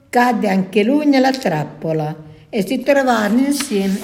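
ordinary delivery

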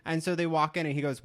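usual speaking voice

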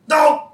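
short, high, loud yelp; frustrated cry. Retold or imitated. Husband who has cornered himself, frustrated yelp. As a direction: burst out in sudden dismay. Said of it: "So you just hear".

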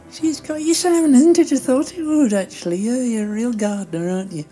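high-voiced chatty Doris. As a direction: voice Doris high above your own, chatty.

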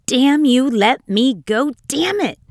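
loud, high furious cursing; a boy yelling curses at his mother in a tantrum, furious and loud; angry child yelling. A narrator's reading. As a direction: shriek it at your mother like a brat.

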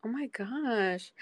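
ordinary talk, nothing marked

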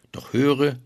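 a hooded dwarf calling her to listen. Acted. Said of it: "sprach ein Kapuzenmann".

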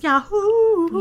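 high, stretched yodel cry; Yahoo yodel. Acted. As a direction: sing out the Yahoo call. Yodels the brand name.

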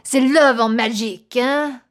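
playful French actress voice, hammed up; French accent. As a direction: drop into a mock French accent. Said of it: "hire me to play a French actress".

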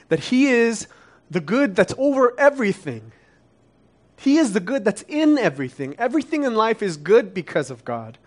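climbing fervent sermon voice; passionate preaching. Real, not acted. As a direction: preach it out with rising conviction. Preacher building to passionate climax.

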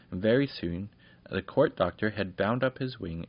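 ordinary delivery

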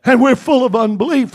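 loud, rising sermon at full force; fiery preaching. Acted. Preacher pleading with his church, loud and fiery. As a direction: preach it hard to the congregation.

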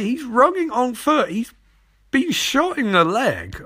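high, loud, hammed-up disbelief; exasperated scolding. Scolding Barry for not catching Clay, acted exasperated rant. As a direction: scold in high-pitched, incredulous outrage.

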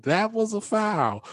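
high, slow, indignant protest; retold outburst. Coach mocking the referee's foul call, high slow indignation. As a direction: spell it out slowly to the ref, way up high. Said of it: "I went off".

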